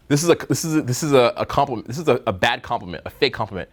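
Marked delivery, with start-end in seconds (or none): none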